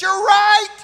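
sudden high shout; yelled out. Retold or imitated. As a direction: blurt it out as a yell. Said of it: "She yelled out".

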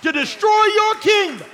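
preacher shouting a vow against the devil; defiant shout at the devil. Pretend. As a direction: shout it high and loud, furious.

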